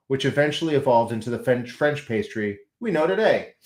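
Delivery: ordinary delivery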